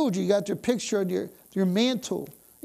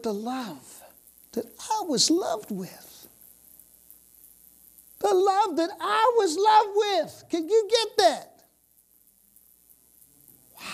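high, loud, fervent preaching; full-force preaching; 6 semitones higher, 4 dB louder than usual. Acted. Preacher rousing the church, full-force fervent preaching. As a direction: preach it with fire.

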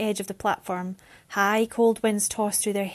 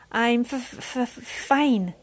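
stuttering through chattering teeth, slow; 3 semitones higher, much slower than usual. Acted. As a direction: shiver the words out. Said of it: "she chattered".

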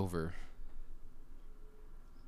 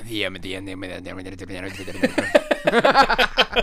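tongue twister fast; 11 dB louder, much faster than usual. Shown as a demonstration. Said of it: "Say that three times fast".

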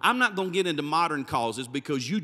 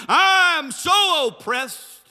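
slow, loud, higher mocking whine; 9 semitones higher, 9 dB louder, much slower than usual. Preacher mocking angry millionaires, slow loud mock whine. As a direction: drag it out like a spoiled millionaire complaining. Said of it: "millionaires running around going".

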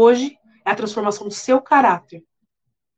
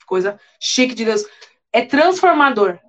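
ordinary delivery

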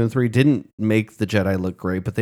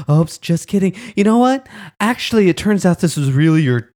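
much higher and louder, sarcastic fake cheer, soaring on the Jedi part; gushing, patronising mock praise, girl; 9 semitones higher, 4 dB louder than usual. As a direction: parody the council as it pretends it was all a test. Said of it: "these arrogant prick Jedis are like".

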